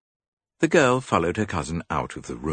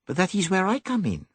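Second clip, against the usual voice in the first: higher, fussy little detective; higher dapper detective; Poirot voice; 3 dB louder than usual. A narrator's reading. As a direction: play the clever Belgian.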